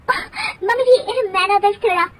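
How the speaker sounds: put-on high, flustered girlish voice; young bahu caught out. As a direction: stammer an excuse, caught mid-dance. Daughter-in-law caught dancing.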